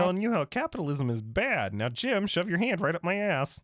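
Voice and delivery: high, nasal-free froggy puppet voice; chirpy muppet frog impression; Kermit the Frog voice. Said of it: "I just got the".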